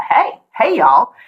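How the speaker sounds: bright-pitched Southern "hey, y'all"; Southern greeting. Shown as a demonstration. Greeting people as Southerners do, Southern accent. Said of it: "the hallmarks of my Southern speech is hey".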